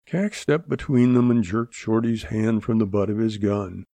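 plain reading voice

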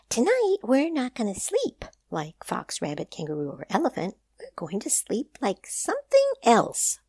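high, bold proud announcement; announcing. A narrator's reading. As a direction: Rabbit declares the plan. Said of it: "Rabbit announced".

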